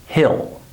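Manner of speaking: HILL with a short lax I; HILL, no long EE; short I vowel. Shown as a demonstration. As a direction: say HILL as the hill you climb. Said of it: "I pronounce it the same way".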